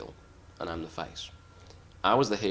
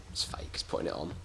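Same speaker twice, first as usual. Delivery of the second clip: whisper; 5 semitones lower, 9 dB quieter than usual. Real, not acted. Aside to Alex backstage.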